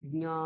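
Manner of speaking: জ্ঞা as a nasal gnya; correct Sanskrit pronunciation. Shown as a demonstration. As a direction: let ny sound in জ্ঞা. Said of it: "আমরা কি বলবো".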